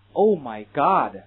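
American film exclamation, loud; American oh my God. Retold or imitated. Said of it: "美式电影中都这么读的".

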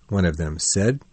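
ordinary delivery, nothing marked

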